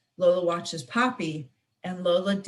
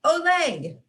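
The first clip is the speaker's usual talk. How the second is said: shouted olé; 7 semitones higher than usual. Acted. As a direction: cheer her on like a flamenco crowd. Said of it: "shouts Tia Clara".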